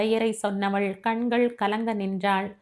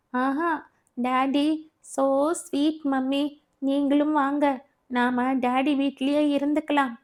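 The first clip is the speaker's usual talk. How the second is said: lightly voiced pleading child, a bit higher; a small daughter coaxing her mommy; sweet begging child, slowed on so sweet; little girl voice; 5 semitones higher, slower than usual.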